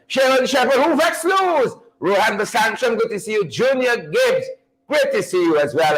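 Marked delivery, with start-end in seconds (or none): none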